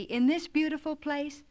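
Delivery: high, wondering question; amazed and delighted; excited. A narrator's reading. Phronsie delighted.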